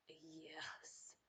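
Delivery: breathy whispered yes; a soft whispered yes over the smell. Retold or imitated. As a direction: whisper it like savoring the aroma. Recalling the smell.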